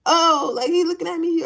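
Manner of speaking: lightly hammed-up shy girl; flustered shy girl. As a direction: squeal like a shy girl noticing him. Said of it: "the type of girl that's like".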